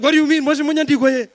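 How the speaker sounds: loud, high outraged father; shouting dad in another language; angry father. Retold or imitated. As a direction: bark it like a fed-up dad. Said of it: "My father's like".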